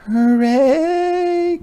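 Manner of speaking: very high, loud hooray; very high loud hedgehog cheer; joyful cheer. A narrator's reading. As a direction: cheer with relief. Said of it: "cried Hedgehog".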